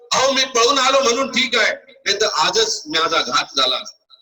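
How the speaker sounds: high, insistent acted outcry; shouting. Battered priest bursts into court to the king, shouting. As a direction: cry out in high-pitched protest. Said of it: "जोरात ओरडतोय".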